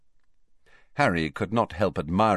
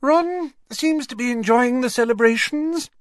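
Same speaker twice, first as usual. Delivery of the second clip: much higher and louder, strained; unnaturally high-pitched; 5 dB louder than usual. A narrator's reading. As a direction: force it up too high. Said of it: "in an unnaturally high-pitched voice".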